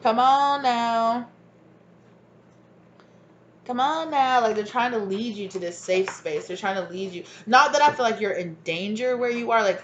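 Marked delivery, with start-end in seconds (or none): 0.0-4.4 coaxing ancestor. Retold or imitated. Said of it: "they're like".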